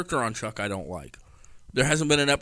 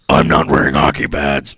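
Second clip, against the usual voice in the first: deep raspy growl; low gravelly superhero growl; Batman voice; 8 semitones lower, 11 dB louder than usual. Retold or imitated. Repeats Batman impression.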